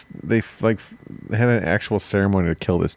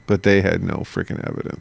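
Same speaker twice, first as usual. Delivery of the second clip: normal talking voice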